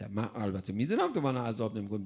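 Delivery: ordinary talk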